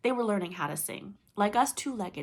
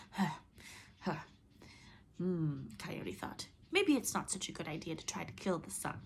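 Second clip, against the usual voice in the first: out of breath; 3 semitones lower, 6 dB quieter than usual. Acted. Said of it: "tongue hanging out of his mouth".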